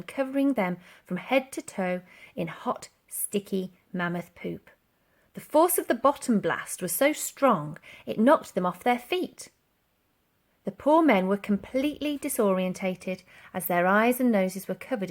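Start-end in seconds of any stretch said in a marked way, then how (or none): none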